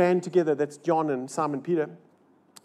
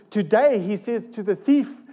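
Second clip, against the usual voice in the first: ordinary talk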